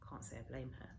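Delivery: a wry whisper to the listener; whispered aside. Acted. Boy joking about the pudding, whispered aside. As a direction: breathe it as a dry aside.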